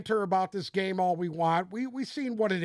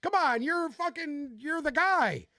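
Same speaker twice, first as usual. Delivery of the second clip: high-pitched incredulous rant, retold; pitched-up mock outrage at the coach; exasperated disbelief; 7 semitones higher than usual. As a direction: replay the moment you couldn't believe the call. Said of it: "I was like".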